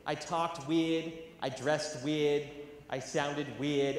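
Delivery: slow and loud, every word hit; emphatic. Real, not acted. Telling the audience why he was teased, slow emphatic. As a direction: lay it out slowly, with weight. Stressing being the weird kid.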